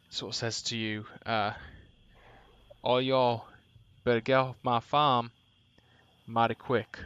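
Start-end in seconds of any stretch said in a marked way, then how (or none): 2.8-7.0 lightly voiced hillbilly threat; hillbilly accent. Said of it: "like an absolute hillbilly".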